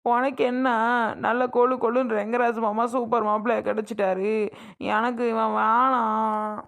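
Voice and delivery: bitter, weepy refusal; crying protest. Acted. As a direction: complain tearfully, dragging out the final refusal. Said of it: "பேசாம அழாம இரு". (அழுகையோடு மறுக்கும் குரல்)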